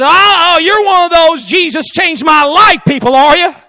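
a scornful stranger jeering at a believer; mocking skeptic. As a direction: scoff at her, loud and high. Scoffing skeptic mocks the girl.

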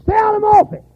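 high, loud, scolding bark; gruff doctor scolding. Retold or imitated. Hard-headed doctor to a disobedient patient, loud scolding. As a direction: tell off a patient who won't listen. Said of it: "hard-headed doctors ... say".

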